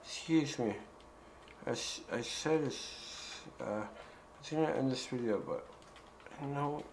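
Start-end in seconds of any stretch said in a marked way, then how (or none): none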